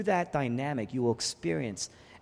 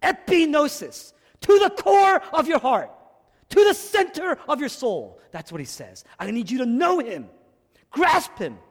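much higher and louder, slowed on epignosis; full-force preaching; 9 semitones higher, 9 dB louder than usual. Acted. Preacher urging the congregation to know him, crying out. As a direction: cry it out from the pulpit.

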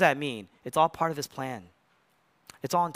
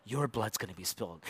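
hushed, soft; 8 semitones lower, 12 dB quieter than usual. Acted. Father voiced softly to Jesus.

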